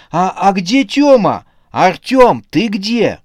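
high, loud panicked calling out; searching for him in a panic; frightened calling. A narrator's reading. Wife searching for husband.

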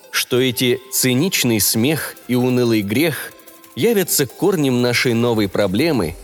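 usual speaking voice

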